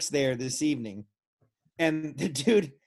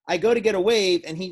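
normal talking voice

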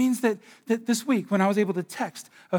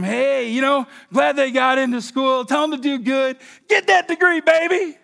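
mock cheery pep talk; 7 semitones higher, 5 dB louder than usual. Mocking shallow dad encouragement.

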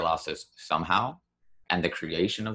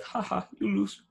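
mocking PC gamer. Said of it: "PC gamers are like".

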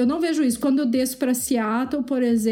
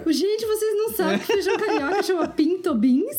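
laughing; 8 semitones higher than usual. Real, not acted. Laughing at the word pinto.

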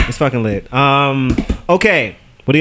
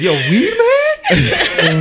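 surprised quote; much slower than usual. Retold or imitated. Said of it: "Lou is taken off guard".

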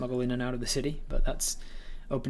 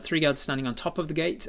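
usual speaking voice

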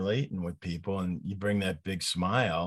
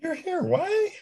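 high, squeaky disbelief; incredulous high voice; 3 dB louder, slower than usual. Retold or imitated. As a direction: ask it like you can't believe it. Voicing puzzled Jesuit school staff.

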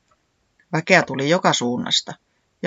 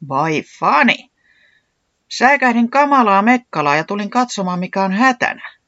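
raised, louder voice of mild annoyance; slightly miffed; 6 semitones higher, 5 dB louder than usual. A narrator's reading. Katiaa kansa complains about the awful racket, slightly miffed. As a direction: protest with a touch of irritation. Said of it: "hivenen näreissään".

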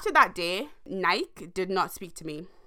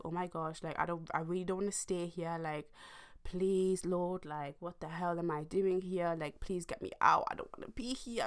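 desperate quiet prayer; 9 dB quieter than usual. Retold or imitated. Praying on the toilet.

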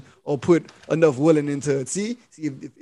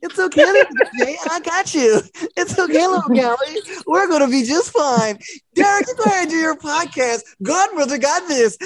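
put-on high, sweet coo to the kids; sweet godmother; 8 semitones higher than usual. Godmother cooing at the little kids, high and sweet. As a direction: soothe the little ones like a doting godmother.

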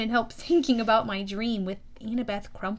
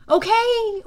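a high, loud first okay; a raised okay that settles down on the next; loud anxious okay; 9 semitones higher, 7 dB louder than usual. Acted. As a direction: call it out to stop the panic. Hero settles panicking creatures.

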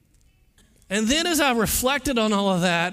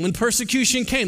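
ordinary delivery

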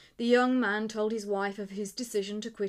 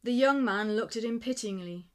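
usual speaking voice

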